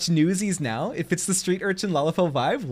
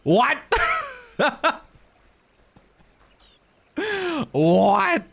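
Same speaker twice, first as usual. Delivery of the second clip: high, loud stunned what; shocked what; 12 semitones higher, 3 dB louder than usual. Real, not acted. Streamer learning the mount is dead, stunned. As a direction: blurt it out in disbelief.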